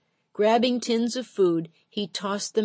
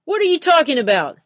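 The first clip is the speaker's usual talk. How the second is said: high, loud angry yell; 10 semitones higher, 11 dB louder, faster than usual. A narrator's reading. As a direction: yell it at the counter. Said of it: "the fisherman yelled".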